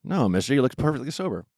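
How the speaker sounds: normal talking voice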